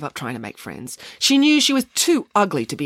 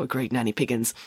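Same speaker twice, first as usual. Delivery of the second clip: plain reading voice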